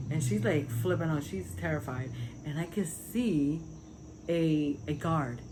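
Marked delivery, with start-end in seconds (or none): none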